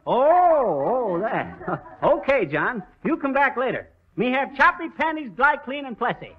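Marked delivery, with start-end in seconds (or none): 3.0-6.3 imitating the customer's broken English, pitched up a little; lightly mocking the customer's way of talking. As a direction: talk down to him in his own broken phrasing. [name] mimics the customer's broken English.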